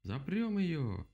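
high, drawn-out call; rallying cry. A narrator's reading. Animals lock the owl in, rallying cry. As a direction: call it out slowly to the gang.

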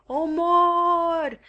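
high, loud desperate cry of murder; distant cry. A narrator's reading. A voice in the marsh crying murder, high and loud. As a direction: yell it from far off across the marsh. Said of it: "o voce care striga".

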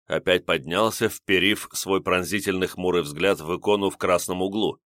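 usual speaking voice

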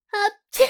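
high little sneeze; cute sneeze. A narrator's reading. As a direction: sneeze it sweetly. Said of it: "Мило чихнула девушка".

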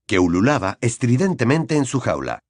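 ordinary delivery

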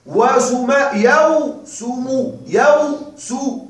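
"يوسم" drawn out very slowly to show the kept waw; very slow demo. Shown as a demonstration. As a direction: stretch "وسم يوسم" slowly for the class. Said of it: "آه تبقى الواو". (نطق "وسم يوسم" ببطء شديد للتوضيح)

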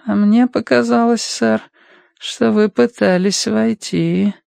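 high, thin voice, biting off words; Adam Wilson. A narrator's reading. Financier contradicts Matthew at the door, thin spiteful nagging. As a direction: nag with thin, spiteful precision, slowing at the end. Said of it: "будто злобно откусывали куски воздуха".

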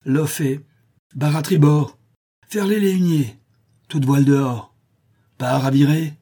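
loud, high captain's commands; shouted orders. Acted. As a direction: bark the orders like a ship captain. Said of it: "donnant ses ordres".

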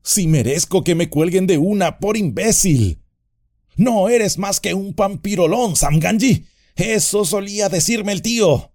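high, eager rambling; excited self-scolding. A narrator's reading. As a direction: scold yourself with relief. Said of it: "excitado y aliviado".

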